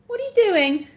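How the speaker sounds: loud, high-pitched shouted question; calling out. Real, not acted. Calling to child upstairs.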